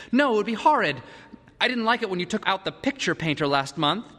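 put-on high, emphatic child's objection; petulant son. A narrator's reading. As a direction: refuse like a spoiled boy. Peter protests to father.

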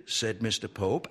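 ordinary delivery, nothing marked

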